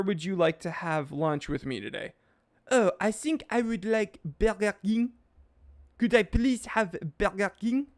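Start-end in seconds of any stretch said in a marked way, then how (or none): 2.7-7.9 higher-pitched mock Frenchman; hammed-up French accent, mangling the English names; thick French accent. Frenchman answering where he'd like lunch, thick French accent. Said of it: "You're right, Mister Frenchman".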